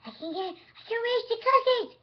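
put-on high, loud little-girl voice straining to reach; excited little girl cat voice; cat voice reaching for cookies. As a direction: squeal it out like a small kid stretching for the cookies. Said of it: "She's all like".

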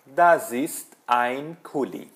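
slow, distinct German with falling end; careful teacher's example sentence; model answer. Shown as a demonstration. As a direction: give the answer word by word. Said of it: "Você vai dizer".

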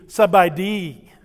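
slow Sabai di, stress on Sabai falling; Thai reply. Shown as a demonstration. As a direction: answer in Thai, slowly. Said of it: "Let me hear you say that".

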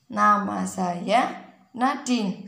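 put-on slow, deliberate self-introduction for a toddler; coaching a child word by word; slow spelled-out demo. Shown as a demonstration. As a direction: say it slowly so she can copy. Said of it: "Coba bacanya gimana".

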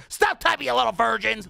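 half-joking shout at chat; mock yell. Real, not acted. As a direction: Stop typing shouted high and loud. Yelling at chat.

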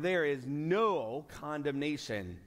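no condemnation said slowly, weight on no; slow emphatic punchline. Shown as a demonstration. Preacher lands the key verse for the congregation, slow and weighty. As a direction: land each word of no condemnation.

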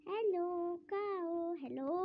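ordinary talk

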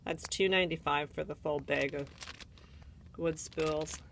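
normal talking voice

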